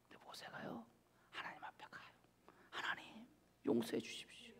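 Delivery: soft whispered storytelling. Acted. Preacher whispers how Moses prays for his sister, hushed. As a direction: lean in and whisper the prayer.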